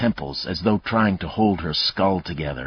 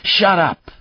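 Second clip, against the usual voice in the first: loud, high angry snarl; 14 semitones higher, 9 dB louder than usual. Acted. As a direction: spit it at the crying girl. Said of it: "Mayle snarled".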